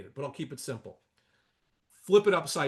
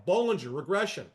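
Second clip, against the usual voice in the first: ordinary talk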